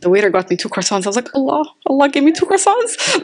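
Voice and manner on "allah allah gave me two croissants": higher, delighted exclamation; overjoyed. Retold or imitated. Preacher reliving finding two croissants, a higher delighted gush. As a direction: gush like you just got a gift. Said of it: "I was so happy".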